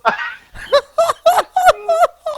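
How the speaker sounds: squealing burst of laughter; high laughing fit. Real, not acted. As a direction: lose it completely. Laughing fit mid-story.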